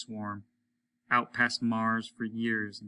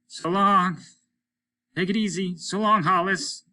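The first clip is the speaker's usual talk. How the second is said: higher, louder gruff voice of Applegate; a rough spaceman calling in, raised voice; Applegate over the radio; 8 semitones higher, 7 dB louder than usual. A narrator's reading. Applegate calling Hollis over the radio, higher and louder.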